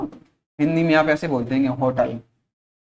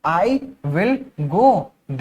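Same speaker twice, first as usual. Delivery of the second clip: slow teaching demo; much slower than usual. Shown as a demonstration. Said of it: "तो क्या बोल रहा है".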